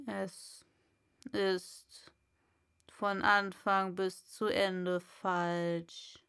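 low, slow, firm caterpillar verdict; slow caterpillar, dead certain; decided caterpillar. A narrator's reading. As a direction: lay it down as final. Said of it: "sagte die Raupe mit Entschiedenheit".

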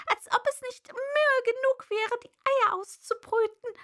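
high, loud pigeon lament; exasperated bird's complaint; distraught pigeon. A narrator's reading. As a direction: pile up the grievances in an agitated bird voice.

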